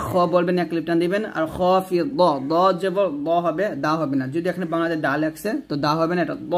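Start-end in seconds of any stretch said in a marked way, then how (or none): none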